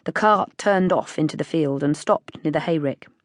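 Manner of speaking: usual speaking voice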